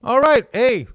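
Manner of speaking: chirpy host signing off; cheery sign-off. Real, not acted.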